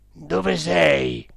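loud séance questioner; cook questioning. A narrator's reading. Cook asking the spirit where it is, loud questioning. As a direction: call out to an unseen spirit. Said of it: "disse finalmente il cuoco".

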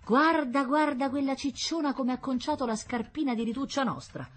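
high, put-on complaining woman; indignant protesting. A narrator's reading. Mother protests over her daughter's ruined shoe, indignant. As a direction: grumble in outrage, high and theatrical. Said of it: "continuava a protestare".

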